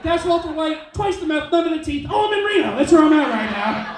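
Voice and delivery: high, loud hammed-up local yelling; a rowdy toothless townie bragging; shrill-free, big mock townie shout; mocking a Reno local.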